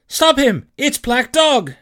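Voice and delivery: high, urgent shout; crying out. A narrator's reading. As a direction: raise the alarm at full voice. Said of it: "I cried".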